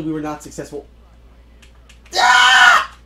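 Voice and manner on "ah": piercing, very high scream; frustrated scream. Real, not acted. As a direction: shriek in frustration. Scream at an in-game death.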